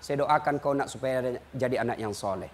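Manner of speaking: soft, low loving answer; tender grandmother. Retold or imitated. Grandmother tenderly answering the grandchild, soft and low. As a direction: gently reassure the child.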